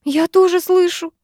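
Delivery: very high, scared bleating; timid high-pitched girl; frightened bleat. A narrator's reading. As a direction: bleat it out in fear. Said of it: "проблеяла Руднева".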